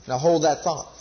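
ordinary talk

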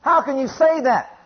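high-pitched, insistent challenge; challenging devil. Acted. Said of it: "the devil says".

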